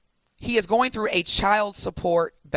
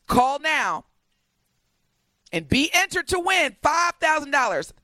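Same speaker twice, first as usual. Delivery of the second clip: hyped promo call; 7 semitones higher than usual. Acted. Closing hype of contest promo.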